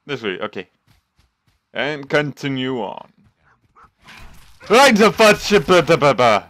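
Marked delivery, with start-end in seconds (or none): 4.7-6.4 loud, high burst of garbled swearing; startled shout. Real, not acted. Gamer jump scared mid-game, startled shouting. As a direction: blurt it out in alarm, high then falling.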